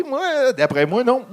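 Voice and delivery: ordinary delivery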